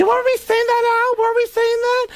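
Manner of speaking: very high, oblivious squeal; Beverly D'Angelo. Retold or imitated. Clueless actress checking with her partner, squealed impression. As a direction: chirp the question way up high. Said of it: "Beverly D'Angelo, completely clueless".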